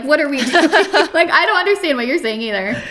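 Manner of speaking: laughing through a mock complaint; amused, laughing comeback; laughing retort. Laughing retort about others' accents.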